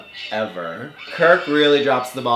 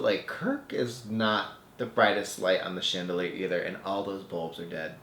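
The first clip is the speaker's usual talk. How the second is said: ordinary talk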